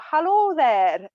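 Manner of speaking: imitating a Scottish friend's hello, not quite right; mock Scottish accent. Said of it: "that was not the best impression".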